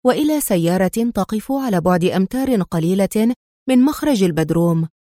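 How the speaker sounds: ordinary delivery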